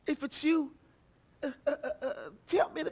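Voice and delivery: hesitant, halting plea with a nervous laugh; stammering Peter. Shown as a demonstration. Preacher acting out a hesitant Peter asking to come. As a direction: stumble through it like a timid Peter.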